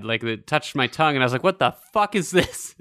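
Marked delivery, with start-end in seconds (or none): 1.4-2.7 mildly horrified, higher-pitched retelling; horrified reaction, played back for the story; retold horror. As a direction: act out a mild shock of disgust.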